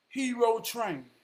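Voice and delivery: ordinary talk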